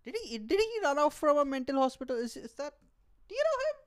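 high, squeaky shocked stranger voice; baffled bystander. Pretend. Strangers in a restaurant reacting to a shouting man, alarmed. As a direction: gawk in disbelief at the weirdo. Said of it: "Everyone would be like".

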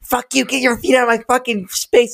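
imitating the older sister screaming at him in the car; mock scream. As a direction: shriek it like the furious older sister. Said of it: "start screaming and being like".

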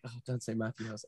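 mock-beg under the breath; soft, fast pleading aside; mock mutter. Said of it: "And I'm like".

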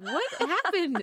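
high, drawn-out retold astonishment; mock bewilderment. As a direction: exaggerate baffled surprise, high and slow. Retold reaction to child's outburst.

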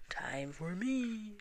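slow and bold, a big billy goat. Acted. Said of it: "said Rough Tough Gruff".

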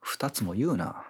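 whispered joke. Real, not acted. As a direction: hush it under your breath. Joking aside.

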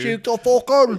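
very high, loud New York yell; over-the-top shouted New York accent. Acted. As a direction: holler it in broad New York. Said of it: "You, you really went New York right there".

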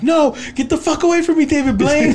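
annoyed high yell. Retold or imitated. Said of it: "He's like".

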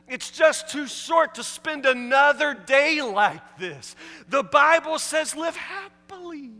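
rising, slowing rebuke from the pulpit; preaching at full force. Acted. Preacher confronts the wives, rising in force. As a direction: drive each question harder.

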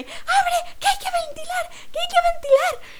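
hammed-up high yell of a panicking woman; frantic woman yelling. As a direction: squeal it like a desperate passenger begging for air. Woman keeps demanding window open.

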